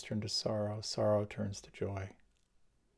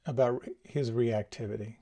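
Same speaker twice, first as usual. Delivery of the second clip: ordinary talk, nothing marked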